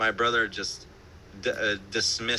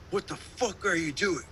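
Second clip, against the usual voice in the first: David Bennett; 7 semitones higher than usual. Retold or imitated. Producer confronting guitarist for playing between takes, mock-indignant high voice. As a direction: imitate an outraged producer in a raised pitch. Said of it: "But David Bennett ... grabs the guitar".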